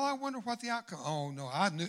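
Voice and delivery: normal talking voice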